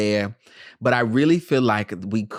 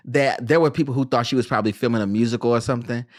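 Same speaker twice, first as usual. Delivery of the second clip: ordinary talk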